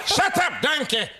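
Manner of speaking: high, loud squawking donkey voice; donkey impression. Guest mimics the cartoon donkey for the hosts, high and loud. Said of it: "he think I'm donkey".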